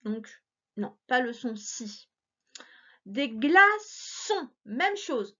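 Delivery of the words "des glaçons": slow, "glaçons" stretched into beats; "glaçons" said slowly, beat by beat; slow syllables. Shown as a demonstration. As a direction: stretch "glaçons" so the son is heard. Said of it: "j'entends son".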